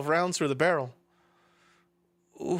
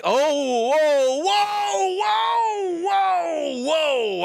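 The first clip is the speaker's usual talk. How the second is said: loud, high, drawn-out whoas; real alarmed shout; 12 semitones higher, 6 dB louder, much slower than usual. Real, not acted. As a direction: shout in startled alarm to halt everything. Startled by on-screen content.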